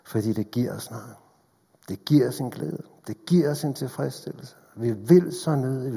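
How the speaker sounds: usual speaking voice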